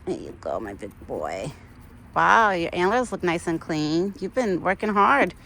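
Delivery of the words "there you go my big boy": tender. Real, not acted. Soft words to the deer.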